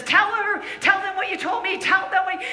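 high, loud pleading push; urgent owner. Retold or imitated. Brothel owner urging the girl to speak, high and loud.

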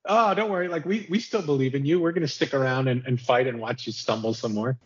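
high, sugary mock comfort, fading down; mock reassurance. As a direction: tease him with fake loyalty. Voicing loyal army mockingly.

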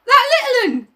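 high gasping schoolboy; gasping Piggy. Acted. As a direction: gasp out the words. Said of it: "gasped Piggy".

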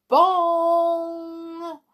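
loud, high ringing bong; clock bell chime. A narrator's reading. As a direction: imitate Big Ben, letting the note ring out. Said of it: "Ben began to chime".

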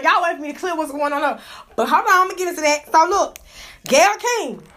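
ordinary talk, nothing marked

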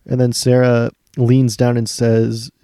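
ordinary delivery

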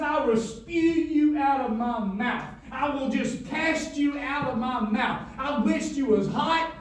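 slow, loud, half-sung preaching; preaching at full force. Acted. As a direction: chant it out like a pulpit climax. Preacher quoting Jesus, sung.

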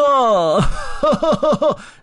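loud rising laugh of delight; happy elephant laughing in the snow; high gleeful laugh. Acted. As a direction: laugh out freely. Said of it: "另一头大象笑着说".

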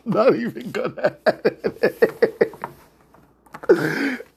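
can barely get it out for laughing. Real, not acted. Host laughing at his own on-air mistake, talking through giggles.

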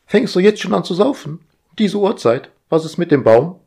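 high, bossy, busy voice; fussy household robot. Acted. Household AI fussing at Roger, high and bossy. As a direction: nag like an overeager house AI.

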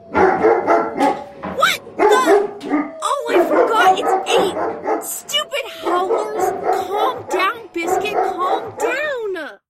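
startled, loud outburst over noise; exasperated shouting. Acted. Owner yells at dog barking at howlers, exasperated. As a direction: shout over frantic barking, at wit's end.

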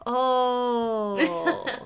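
oh with a laugh in it; amused realization; finally getting it, laughing. Retold or imitated.

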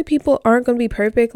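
ordinary talk

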